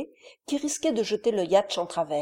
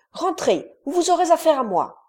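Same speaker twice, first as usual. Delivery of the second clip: louder, fast, higher threat; angry threat; 8 semitones higher, 6 dB louder than usual. A narrator's reading. Gordon threatens the little ones, angry and fast. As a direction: scare them back inside.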